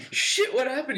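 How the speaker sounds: mock alarm at the crash; startled retold yelp. Retold startled reaction in car.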